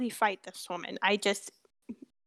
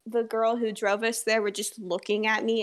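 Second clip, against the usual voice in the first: ordinary delivery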